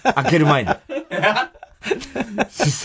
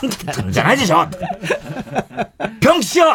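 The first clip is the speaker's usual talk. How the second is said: mock scolding; 12 semitones higher, faster than usual. The lady boss scolding the narrator in bed, a high mock rant. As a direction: scold fast, then shriek the name.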